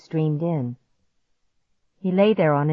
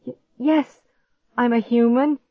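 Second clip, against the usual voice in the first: higher, stammering in fear; 6 semitones higher, 3 dB louder than usual. A narrator's reading. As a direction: stutter it out nervously. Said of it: "I stammered".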